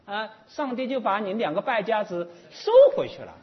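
lightly acted, emphatic reproof; scolding deity. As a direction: explain it forcefully, like a deity setting someone straight. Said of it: "一个好像天神一样的就呵斥他".

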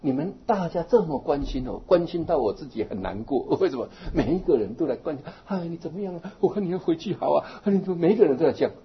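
a hint of an elderly woman overwhelmed by care; old lady complaining. Monk quotes old woman fretting over too much concern, lightly voiced. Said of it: "她说".

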